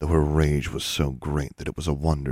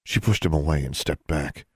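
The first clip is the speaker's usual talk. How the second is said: usual speaking voice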